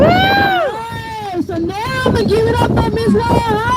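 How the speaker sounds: rally the crowd, voice cracking; excited announcer shout. Real, not acted. Emcee hyping next speaker.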